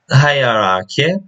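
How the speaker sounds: ordinary delivery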